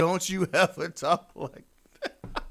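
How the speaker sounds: high, loud, drawn-out mock scolding with laughter; mocking outrage. Mocking the boyfriend's expected reply, hammed-up outrage. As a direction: ham up the devoted boyfriend, stretching every word. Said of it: "He's supposed to say".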